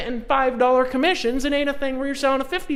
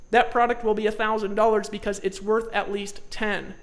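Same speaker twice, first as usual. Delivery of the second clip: usual speaking voice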